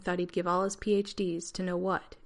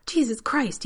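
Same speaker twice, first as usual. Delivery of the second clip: a sudden loud yelp of shock, pitched up; startled exclamation; 6 semitones higher, 7 dB louder, slower than usual. A narrator's reading. Rodney startled by John appearing, loud shocked yelp. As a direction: jump out of your skin and blurt it. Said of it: "he exclaimed".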